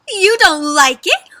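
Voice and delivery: high, loud, drawn-out disbelief; so shocked. A narrator's reading. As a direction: ask in stunned amazement. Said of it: "They're so shocked, right?".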